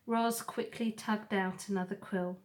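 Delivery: usual speaking voice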